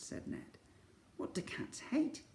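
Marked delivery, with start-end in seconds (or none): 1.2-2.2 whisper. Acted. Slime asks quietly.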